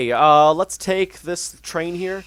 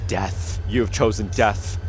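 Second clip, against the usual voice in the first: ominous doom voice; 5 semitones lower, slower than usual. Retold or imitated. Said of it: "the game's like".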